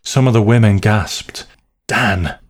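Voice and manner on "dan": plain reading voice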